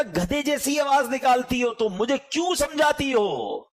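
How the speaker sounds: mocking retort. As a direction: throw it back at her with contempt. Son mocking his mother.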